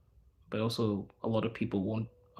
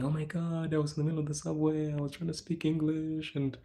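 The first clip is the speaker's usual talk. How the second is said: higher, quicker mock-whiny retelling; complaining foreigner; 6 semitones higher than usual. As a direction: imitate a frustrated expat venting. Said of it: "I've seen people tell me".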